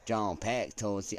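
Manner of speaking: hammed-up broadcast read; news anchor accent. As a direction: deliver it like a network anchor. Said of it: "I don't know why I got into that accent".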